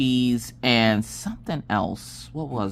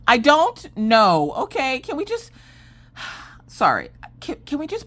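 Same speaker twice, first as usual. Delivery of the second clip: exasperated outburst; 11 semitones higher than usual. Retold or imitated. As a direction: lose patience, then cut yourself off. Snapping at son, then stops.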